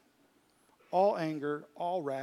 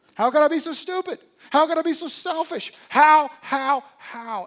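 much higher, much louder cry of self-reproach; anguished self-blame; 10 semitones higher, 16 dB louder than usual. Acted. Preacher voicing our self-blame at the mirror, anguished shouting. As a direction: shout it at your own reflection in despair.

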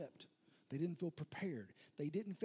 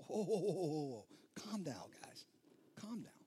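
soothing hush. Retold or imitated. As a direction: settle them down gently. Jesus soothing panicked disciples.

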